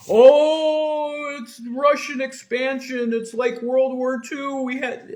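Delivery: high, loud mock-alarmed voice; mocking imitation. Streamer mocking those who back Ukraine funding, exaggerated high alarm.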